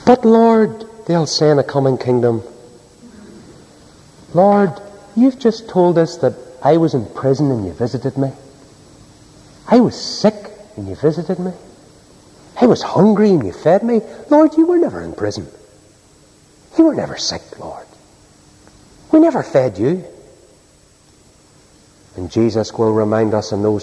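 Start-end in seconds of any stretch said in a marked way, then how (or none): 0.1-0.9 protesting plea. Acted. People protesting to the Lord. 4.3-20.0 incredulous protest. Acted. People protesting to the Lord.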